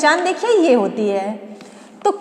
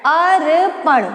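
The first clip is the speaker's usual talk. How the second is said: slowly sounded out; much slower than usual. Shown as a demonstration. Teacher answering her own question by sounding out Arpan. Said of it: "क्या लिखना है student?".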